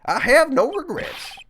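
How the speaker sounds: put-on high, strained elderly man confessing; old man voice. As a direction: play it as a bitter old bailiff. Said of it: "I'll keep his old man voice".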